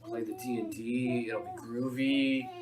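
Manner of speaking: slow, laid-back hippie drawl. Acted. Inviting friends to play D and D, hippie drawl. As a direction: draw it out like a mellow hippie. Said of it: "Now he's going hippie".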